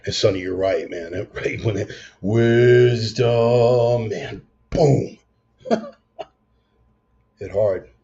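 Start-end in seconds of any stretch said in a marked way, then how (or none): 2.2-7.9 loud, drawn-out wisdom, boom; excited exclaim. Real, not acted. Marveling at Father David's wisdom, loud and excited. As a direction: let the word land big.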